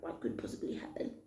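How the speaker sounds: breathy whisper, brushing off his worry; whispered dismissal. Retold or imitated. To baby daddy, whispering it off lightly. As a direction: toss it off in a hushed whisper. Dismissive reply to worried boyfriend.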